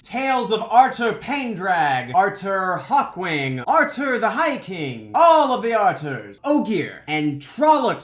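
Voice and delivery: slow, theatrical storyteller's proclamation; grand speech. Retold or imitated. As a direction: roll out each name to the crowd. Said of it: "Tom goes into quite the speech".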